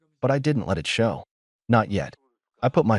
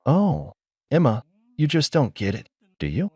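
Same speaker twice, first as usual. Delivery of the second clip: mockingly sweet; 4 semitones higher than usual. A narrator's reading. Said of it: "almost mockingly sweet now".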